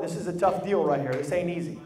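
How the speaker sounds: ordinary talk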